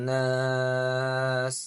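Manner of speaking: measured Quranic recitation. Acted. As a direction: recite slowly with long drawn vowels. Recites the ayah's ending word.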